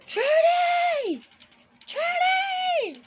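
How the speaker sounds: loud, high shout across the lot; calling out name. Retold or imitated. Someone calling her name across the parking lot, shouting. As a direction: yell her name from far away. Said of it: "having somebody saying".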